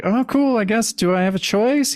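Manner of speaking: high, half-hearted agreement; lightly acted reluctant heroine; mock acceptance.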